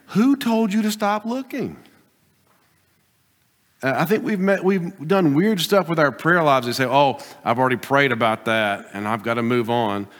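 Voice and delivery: ordinary delivery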